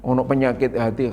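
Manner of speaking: ordinary talk, nothing marked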